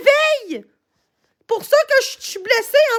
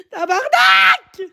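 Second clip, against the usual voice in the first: a slow, drawn-out anguished yell, higher; crying shout; 6 semitones higher, much slower than usual. Real, not acted. Streamer yelling at her silent chat, crying shout. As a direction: let the swear tear out of you in tears.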